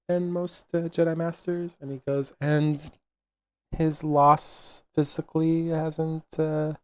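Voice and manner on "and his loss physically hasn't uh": gentle healer voice. Acted. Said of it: "known to have a little bit more outward empathy".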